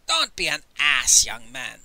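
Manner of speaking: irritated rebuke; angry. A narrator's reading. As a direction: scold sharply, then let it fall. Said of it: "Master Li said angrily".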